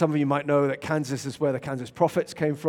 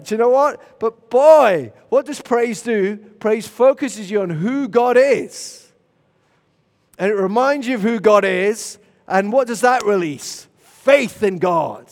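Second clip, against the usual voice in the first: loud, raised-pitch sermon delivery; full-force preaching; 5 semitones higher, 6 dB louder than usual. Real, not acted. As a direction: drive the point home to a congregation.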